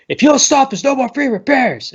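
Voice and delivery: high-pitched imitated ultimatum; mock shouted threat. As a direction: call out a warning to a whole bar, playacting. Doctor threatens brawlers to stop.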